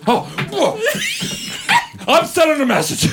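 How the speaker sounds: high, strained imitation of Tom; Tom upset. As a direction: squeak it out, all worked up. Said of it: "And Tom goes".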